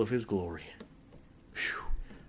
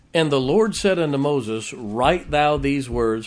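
louder, measured King James reading; scripture reading; 7 dB louder than usual. Acted. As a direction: proclaim the verses to the congregation. Reads scripture aloud.

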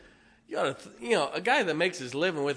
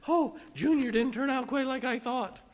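mock fretful parent; 9 semitones higher than usual.

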